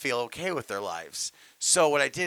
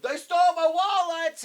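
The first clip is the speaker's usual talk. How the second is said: very high, hammed-up sitcom yell; high mock-panicked screaming; imitated screaming; 13 semitones higher, 7 dB louder than usual. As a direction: shriek it like a robbed sitcom dad. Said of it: "he starts screaming".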